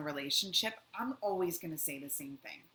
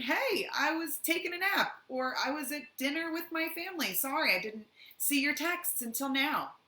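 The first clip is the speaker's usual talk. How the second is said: innocent excuse; 9 semitones higher, 4 dB louder than usual. Retold or imitated. Acting out partner's innocent reply.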